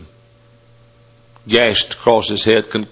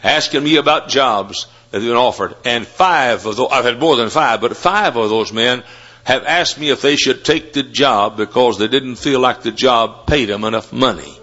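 ordinary talk

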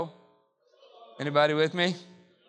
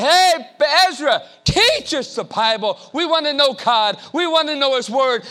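calling out; 7 semitones higher, 6 dB louder than usual. Acted. People calling to Ezra.

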